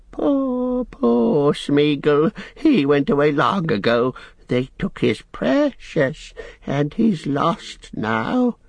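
high, tearful creature lament; Gollum, self-pitying. Acted. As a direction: mourn yourself slowly, near crying.

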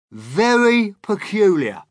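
high, loud, slow relish; storytelling Centipede. A narrator's reading. Centipede begins a strange tale, relishing it. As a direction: draw it out as a loud bug.

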